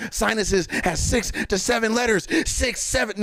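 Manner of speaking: very high, frantic clue-hunter voice; hammed-up conspiracy excitement; mock fan theorist. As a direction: imitate overexcited fans cracking a code. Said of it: "cool shit that y'all come up with".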